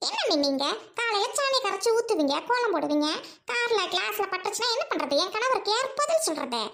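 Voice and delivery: haughty sister scolding at her gate; snobbish rich sister. Acted. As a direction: talk down to the noisy crowd at the gate. Sister scorns village customs.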